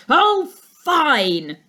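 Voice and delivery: loud, high fed-up exclamation; a weary teacher's loud outburst; exasperated cry. A narrator's reading. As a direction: throw up your hands and cry it out. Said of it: "cried Mister Gilbert".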